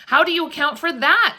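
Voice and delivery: high, loud, horrified challenge; awful voice. A narrator's reading. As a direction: demand it in dread, pointing it out. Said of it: "in an awful voice".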